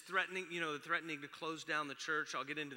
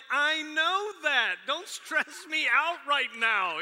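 higher, louder and slow, pleading not to be pressured; exasperated; 10 semitones higher, 10 dB louder, much slower than usual. Retold or imitated. Pastor replaying his stressed reply to his son. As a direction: drag it out, stressed and fed up. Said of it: "I'm looking at him like".

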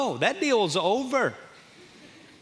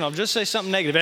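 ordinary talk, nothing marked

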